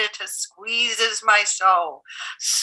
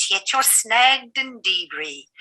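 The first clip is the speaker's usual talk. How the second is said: ordinary delivery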